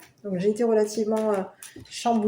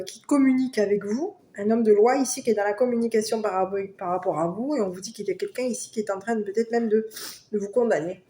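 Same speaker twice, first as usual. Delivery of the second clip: ordinary delivery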